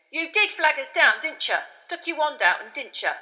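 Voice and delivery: loud, suspicious questioning. A narrator's reading. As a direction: press him suspiciously. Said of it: "looking suspicious again".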